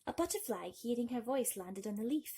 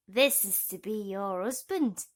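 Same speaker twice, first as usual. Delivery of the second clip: louder smug toad; a touch of toad voice; 8 dB louder than usual. Acted. The toad telling Thumbelina her fate, lightly toad-like. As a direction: announce the match. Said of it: "said the fat old toad".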